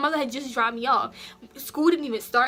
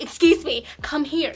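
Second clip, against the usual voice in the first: louder mocking of the principal's bossy call; mock loud command; 4 semitones higher, 6 dB louder than usual. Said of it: "you didn't have to be so loud about it".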